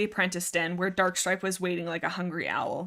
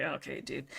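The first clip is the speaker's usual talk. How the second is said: deadpan; 7 semitones lower, 14 dB quieter than usual. Retold or imitated. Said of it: "I'm like".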